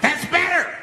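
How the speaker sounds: high, sharp approval in a put-on voice; crazed approval. Crazed man to the silenced bus, high crazed approval. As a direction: pitch it way up, crazed and satisfied. Flasher approves once crowd is quiet.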